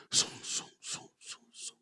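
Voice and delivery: soft, unvoiced zum zum like a machine starting; computer booting noise. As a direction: hum out a computer powering up. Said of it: "la computadora se prende". (zumbido de computadora que arranca)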